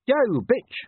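high, loud, cocky dog; brash stray dog. A narrator's reading. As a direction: call out cheekily to a she-wolf. Stray dog accosts she-wolf.

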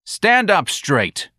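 high, very slow command; barked order. Acted. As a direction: bark each word slowly. Sergeant commands.

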